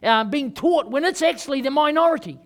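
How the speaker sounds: loud, driving sermon climax; fiery preaching. Acted. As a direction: preach it hard to the congregation. Preaching at full force.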